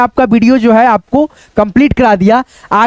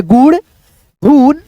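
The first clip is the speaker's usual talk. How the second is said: sounding out; 2 semitones higher, much slower than usual. Shown as a demonstration. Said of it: "ये छोटे छोटे शब्द है".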